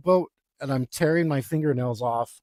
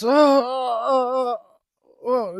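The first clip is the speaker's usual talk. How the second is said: much higher, louder whimpering cries; hammed-up frightened wail; mock wailing; 9 semitones higher, 4 dB louder, slower than usual. Guy about to tip the kayak, mock frightened wail.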